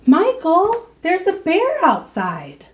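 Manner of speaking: high, loud, urgent call; a kid bursting with news of a bear; breathless child calling to his brother; excited boy. Acted. Boy calls out about a bear.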